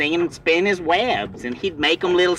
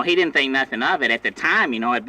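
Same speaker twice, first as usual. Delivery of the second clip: ordinary delivery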